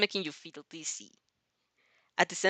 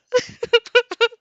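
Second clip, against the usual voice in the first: high burst of laughter; loud giggle; 11 dB louder than usual. Real, not acted. Narrator laughing after asking what a yakuza is, high giggles. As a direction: crack up loudly.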